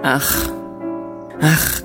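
ordinary delivery

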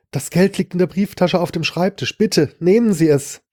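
pleading victim. Acted. Victim offers money at gunpoint.

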